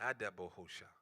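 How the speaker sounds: ordinary talk, nothing marked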